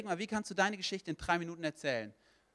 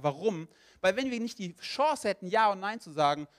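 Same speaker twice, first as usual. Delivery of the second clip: raised, higher pulpit voice; impassioned preaching; 6 semitones higher, 5 dB louder than usual. Real, not acted. Preacher tells the congregation God wants all saved, impassioned preaching. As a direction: press the message home with force.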